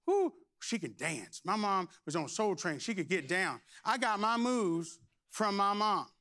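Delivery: ordinary talk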